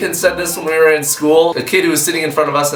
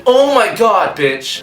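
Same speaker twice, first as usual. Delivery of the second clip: sassy mock; 6 semitones higher, slower than usual. Mocking a classmate's dumb idea, sassy. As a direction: snap it out with sass. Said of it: "mocking classmate".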